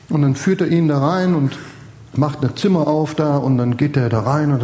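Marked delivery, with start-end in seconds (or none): none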